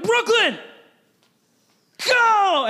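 high, loud urgent call; husband warning his wife of the snake, shouting; panicked shout. Retold or imitated. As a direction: yell at her to get moving.

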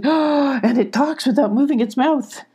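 high, nervous amazement; nervous goat. A narrator's reading. Goat alarmed at a talking stranger, high and nervous. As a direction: gasp and marvel nervously in a high voice. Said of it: "cried the goat nervously".

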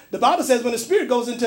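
preaching. Acted. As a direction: preach it up with force. Preacher building to full force.

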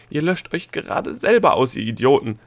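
loud, high outburst tipping over; cracking-voiced shout. A narrator's reading. Gnome yelling at those erasing themselves, voice cracking. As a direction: cry out until the voice flips. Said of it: "rief er mit überschnappender Stimme".

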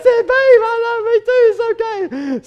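calm her down gently; soothing reassurance. Retold or imitated. Husband soothing his angry wife, high reassuring tone. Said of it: "I said".

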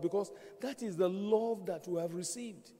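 ordinary talk, nothing marked

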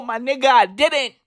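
ordinary talk, nothing marked

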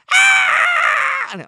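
long, very high, loud scream; choir-soprano child wail. Retold or imitated. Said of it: "合唱团的啊". (模仿小孩高音部式大哭)

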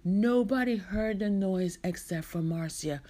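ordinary delivery, nothing marked

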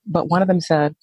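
usual speaking voice

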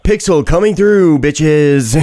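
loud, high, cocky entitled shove; entitled customer. Pretend. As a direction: barge through like you own the place. Mock entitled power user.

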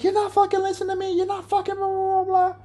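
very high, fast squeaky nagging imitation; mocking angry partner. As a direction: mimic a partner's rant in a mocking falsetto. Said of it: "And she's like".

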